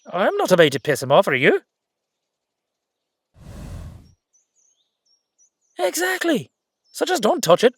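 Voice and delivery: a wound-up little fellow warning you off his gold, high and loud; shrill excited second voice. Acted. Leprechaun warning a friend not to touch the gold, high and loud.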